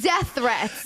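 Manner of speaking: ordinary talk, nothing marked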